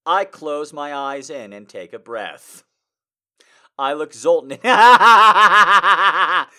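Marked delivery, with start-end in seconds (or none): 3.8-6.5 cracking up mid-sentence; laughing. Real, not acted. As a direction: breaks into laughter while reading. Laughing while reading.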